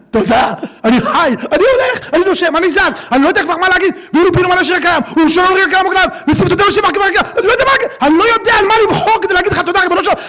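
high, loud, breaking voice pouring out thanks; crying out gratitude to God, fast and choked; tearful thanks. Real, not acted. Speaker crying out thanks to God, voice breaking.

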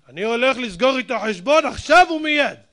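loud, high, heated threat; angry vow. Acted. Offended man vowing to settle the score, angry outburst. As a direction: burst out determined to have it settled. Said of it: "אתה כועס עכשיו?".

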